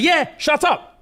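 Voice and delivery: very high mock-angry comeback; imitated heated put-down; mock retort. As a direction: throw it back in a hammed-up high voice. Acted quarrel, answering back.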